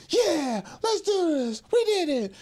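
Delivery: re-enacted high-pitched happy cheer; excited celebration. Player recalling the win over Toledo, re-enacted excited cheer. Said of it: "I was excited, and I was very happy".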